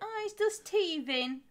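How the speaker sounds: high-pitched mock cooing excuse; imitating sister. Sister excusing her new dog, high mock doting voice. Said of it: "She went".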